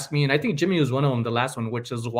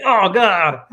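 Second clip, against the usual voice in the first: mock horror; 7 semitones higher, 10 dB louder than usual. Horrified reaction to the quote.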